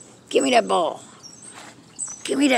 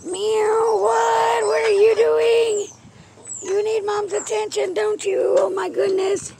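high pet talk; 9 semitones higher, slower than usual. Acted. Owner fussing over her cat wanting attention, high baby talk.

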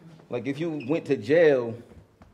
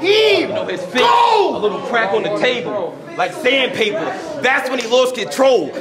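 high, loud excited yell; hyped shout; 10 semitones higher, 8 dB louder than usual. Acted. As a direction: scream it over the crowd. Repeated bar, hyped.

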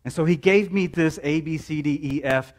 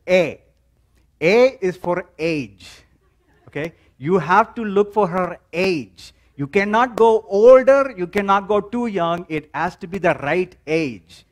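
put-on father's Indian accent, higher and louder, slow; 4 semitones higher, 5 dB louder than usual. Dad teaching his son how to find a mate, higher Indian accent. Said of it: "I'm gonna throw in the Indian accent".

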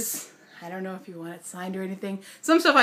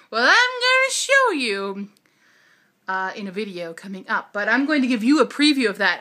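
normal talking voice

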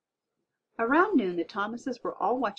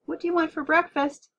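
raised, high-pitched mom's call; shouted; 9 semitones higher, 5 dB louder than usual. A narrator's reading. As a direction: call out over the TV noise. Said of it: "Mom shouted".

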